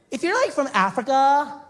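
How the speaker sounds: put-on high, slow ditzy girl voice. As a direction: play the airhead beach girl, drawn out. Surfer girl's question continued.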